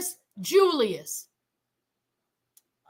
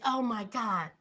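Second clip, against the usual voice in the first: low, hushed "Oh my God"; stunned hush; 9 semitones lower, 9 dB quieter than usual. Retold or imitated. Retelling her own shocked reaction, low and hushed. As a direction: let it drop out low in shock. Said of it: "I said".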